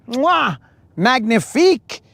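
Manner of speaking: lightly hammed French chef kiss; a hint of a French chef praising. Chef's kiss to the lure.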